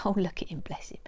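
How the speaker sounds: soft whispered aside to the dog; hushed, fond murmur; tender aside. Real, not acted. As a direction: coo quietly at the sleeping dog.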